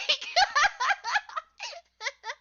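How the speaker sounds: loud high-pitched burst of laughter; cracking up loudly. Real, not acted. Cracking up at own joke.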